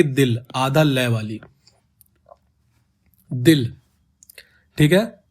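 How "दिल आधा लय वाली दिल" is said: दिल said as one closed syllable with a half ल; Urdu sounding. Shown as a demonstration. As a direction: demonstrate दिल as Urdu speakers say it. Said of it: "sound कैसे आएगी". (दिल को उर्दू उच्चारण में, आधा ल)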